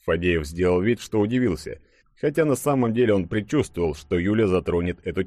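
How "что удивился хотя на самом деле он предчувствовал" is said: ordinary delivery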